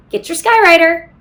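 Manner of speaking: usual speaking voice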